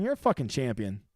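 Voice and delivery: usual speaking voice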